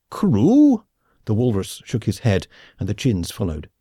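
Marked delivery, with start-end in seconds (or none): 0.1-0.8 higher, louder echo; surprised echo. A narrator's reading. Khan echoing Smith's question, surprised. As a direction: toss the word back in disbelief.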